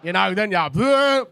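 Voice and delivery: slow, loud second bleh; drawn-out mock vomiting. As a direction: ham up the retch bigger. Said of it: "the sound you do not wanna hear".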